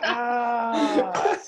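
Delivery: high, drawn-out ah; imitated realisation. Reacting to his colleague's reveal, mock realisation. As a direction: act out the penny dropping.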